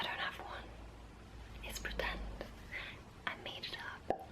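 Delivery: whispered confession. Acted. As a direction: secret stage whisper. Joking aside about her accent.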